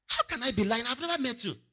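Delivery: high-pitched, incredulous protest; protesting innocence. Acted. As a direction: object with the voice pitched right up. Artist protesting in acted argument.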